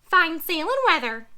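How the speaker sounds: high, loud squawky parrot. Acted. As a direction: chirp it out like an anxious parrot.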